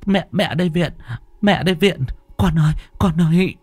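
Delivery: high, anguished calls to her daughter; anguished crying out. A narrator's reading. Mother calls out to her possessed daughter, high anguished plea. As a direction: plead with the dead child to come back. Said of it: "mắt long sòng sọc".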